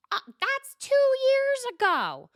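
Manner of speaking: very high, loud, slow protest; mock flustered squeak; squeaky drawn-out denial; high defensive squeal. [name] defensive.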